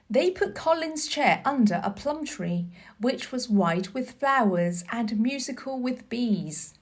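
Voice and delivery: ordinary delivery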